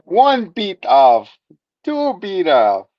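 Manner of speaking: high, slow, loud mock count; counting vampire. Co-host joking as the counting vampire, slow and hammed-up. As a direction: count grandly, stretching each phrase.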